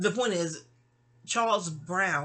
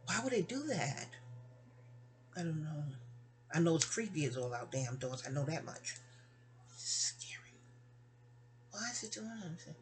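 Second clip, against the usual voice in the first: hushed, uneasy wondering; creeped out; 3 semitones lower, 9 dB quieter than usual. Real, not acted. Speaker unnerved by a phone acting strangely, hushed and creeped out. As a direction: softly puzzle over something eerie, voice sinking. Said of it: "this phone is giving me the creeps".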